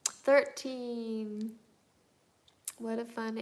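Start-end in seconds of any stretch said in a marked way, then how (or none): none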